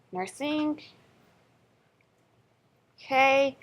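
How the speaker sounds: ordinary talk, nothing marked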